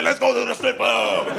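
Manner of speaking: a sudden high shout, all in; eager shout. Retold or imitated. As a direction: blurt it out with way too much eagerness. Eager reply to friend.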